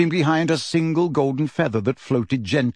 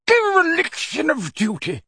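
roaring; 14 semitones higher than usual. Acted. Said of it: "roared a corpulent red-nosed wizard".

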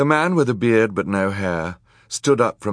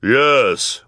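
loud, higher cry of agreement; villainess's cry; 6 semitones higher, 6 dB louder than usual. A narrator's reading. Villainous woman backing her accomplice, loud cry. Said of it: "cried the woman with hair".

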